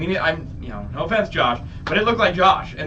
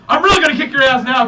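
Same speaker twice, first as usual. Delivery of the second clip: loud, high mock fury; retold threat; 7 semitones higher, 8 dB louder than usual. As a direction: threaten him loudly, acting it out. Climber threatens Trevin, retold.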